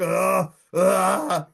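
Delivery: drawn-out mock groan of a swelling belly; two long pained mock groans; imitated groaning. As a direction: groan as if in pain, hammed up. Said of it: "he's, like, groaning".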